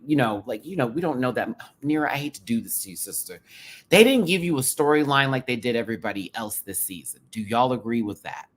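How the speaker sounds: ordinary delivery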